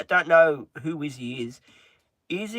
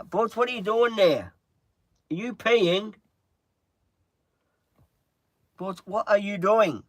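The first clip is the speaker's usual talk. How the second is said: calling the dogs; 7 semitones higher, 4 dB louder than usual. Real, not acted. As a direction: call out to the dogs across the room.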